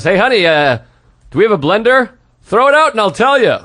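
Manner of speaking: mock husband hollering to his wife; calling out. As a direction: call loudly across the house. Acted husband calling out in bit.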